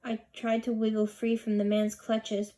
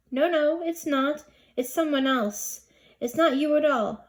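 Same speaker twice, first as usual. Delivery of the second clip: higher and louder, rushing to deny; frantic no, no, it's not; a flustered girl denying her name on the paper; panicked denial; 5 semitones higher, 5 dB louder than usual. A narrator's reading.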